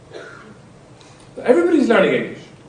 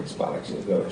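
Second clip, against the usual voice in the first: whispered, hushed gibberish like a shop lady on the phone; mock foreign phone babble; 12 semitones lower, 15 dB quieter than usual. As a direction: murmur fake foreign words under the breath.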